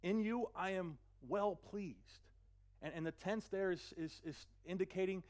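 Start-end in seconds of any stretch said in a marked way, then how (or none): 0.0-2.2 very slow, tender declaration; slow, gentle divine blessing; voice of God. Acted. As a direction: linger on every word. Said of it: "it says".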